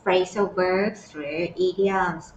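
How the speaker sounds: drawn-out, word by word; very slow careful term. Shown as a demonstration. Teacher to students, introducing the topic, very slow and careful. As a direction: spell the term out slowly for learners. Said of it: "teacher introduces the term".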